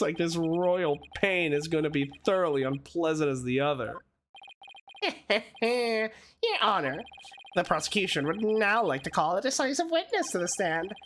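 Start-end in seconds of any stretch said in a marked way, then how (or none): none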